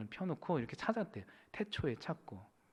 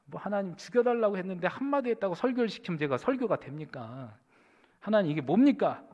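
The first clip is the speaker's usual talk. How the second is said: raised-pitch protest, louder at the end; resentful complaint; 5 semitones higher, 4 dB louder than usual. Retold or imitated. Pastor protesting to God about being made to preach, resentful complaint. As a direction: grumble up at God in retold indignation. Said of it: "또 목사님 원망하기 시작하는".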